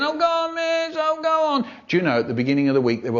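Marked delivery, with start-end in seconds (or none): 0.0-1.7 very high pleading whine; whiny kid. Acted. Whiny kid begging the teacher, whining. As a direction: wheedle like a child begging. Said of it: "a whiny kid going".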